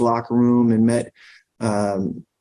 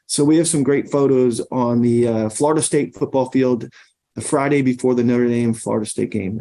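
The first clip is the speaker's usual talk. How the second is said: usual speaking voice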